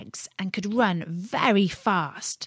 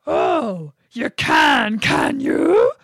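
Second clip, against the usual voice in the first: loud, high roaring bear challenge; growling bear; 7 semitones higher, 9 dB louder, much slower than usual. Acted. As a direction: roar it like an angry bear. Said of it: "growled the bear".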